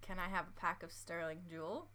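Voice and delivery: quiet, careful try at a British accent; softly attempted British accent. Shown as a demonstration. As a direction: tentatively put on your co-host's British accent. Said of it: "In my accent or your accent? Yours".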